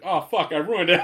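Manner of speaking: half-laughing oh no; laughing dismay. Retold or imitated. Realizing he spoiled gift.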